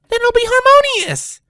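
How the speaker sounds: high, loud, bubbly announcement; excited. A narrator's reading. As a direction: burst out with delight. Pinkie cheerfully declares a conclusion.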